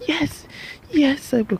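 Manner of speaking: put-on very high, sing-song girlish yes; high-pitched imitation of the smitten girl saying yes; mock girly acceptance. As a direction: squeak out a delighted yes.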